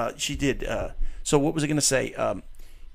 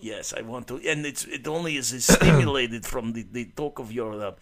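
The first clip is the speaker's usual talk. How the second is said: hammed-up Russian guy accent, held for the bit. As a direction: keep the joking Russian voice going. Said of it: "Very good accent".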